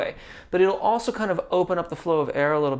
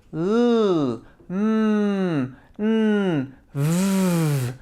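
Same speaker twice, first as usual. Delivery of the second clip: voiced consonants; 3 semitones higher, much slower than usual. Shown as a demonstration. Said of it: "Voiced consonants".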